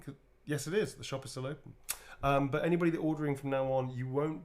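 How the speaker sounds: normal talking voice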